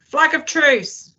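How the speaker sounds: high-pitched drawn-out hail; high, stretched call. A narrator's reading. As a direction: call it out slowly across a distance. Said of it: "I heard someone say".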